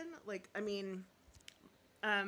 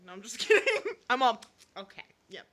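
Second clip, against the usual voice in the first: laughing; 2 semitones higher, 14 dB louder than usual. Real, not acted. Laughing off a joke about Karen.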